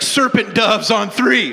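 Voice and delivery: loud laughing huddle call; team break call. Pretend. Coach calling the huddle break, loud and laughing. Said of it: "He's like".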